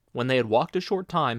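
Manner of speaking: ordinary delivery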